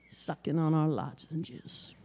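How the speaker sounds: put-on slow, soft, old road-weary lady voice. [name] channels the poet for the crowd, slow and soft. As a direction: drag it out like a tired old poet. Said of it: "[name] just did an impersonation of her".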